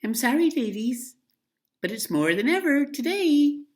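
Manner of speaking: put-on high, loud boyish call; a delivery boy breaking bad news to the ladies. Delivery boy apologizing to the washerwomen, high and loud. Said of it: "would say".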